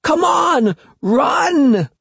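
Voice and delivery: high, urgent panicked cry; hoarse, high-pitched alarm; shrill, frightened. A narrator's reading. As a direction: urge them on in shrill fear. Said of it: "his hoarse voice shrill and frightened".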